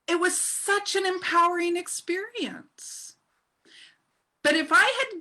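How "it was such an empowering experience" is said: awed whisper. Real, not acted. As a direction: breathe it out in a hushed whisper. Awed recollection of the courtroom.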